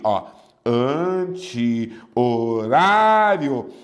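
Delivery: anti-horário drawn out slowly, each part clear; teacher lingering over anti-horário; slow demo. Shown as a demonstration. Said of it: "Tenta escrever junto".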